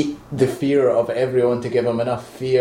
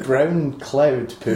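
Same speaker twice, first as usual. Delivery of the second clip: slow drawn-out; 3 semitones higher, much slower than usual. Real, not acted. Mocking Parallax look.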